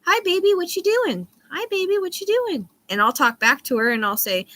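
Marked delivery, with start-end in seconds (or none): 0.1-2.7 much higher chirpy bird voice; mimicking the pet bird's looping greeting; parrot imitation. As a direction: squeak it like a parrot repeating itself. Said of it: "she'll sit there and just go".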